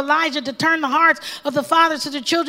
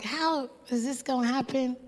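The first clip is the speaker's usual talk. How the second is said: much softer, doubting question; 10 dB quieter than usual. Retold or imitated. Preacher voicing an old priest doubting the angel, softly doubtful. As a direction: ask it as if you can't believe it. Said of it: "like, in doubt".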